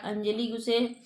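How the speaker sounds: ordinary talk, nothing marked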